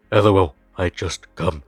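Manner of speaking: halting caveman impression; mock Neanderthal. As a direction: grunt it out word by word like a caveman. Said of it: "Like some fucking Neanderthal".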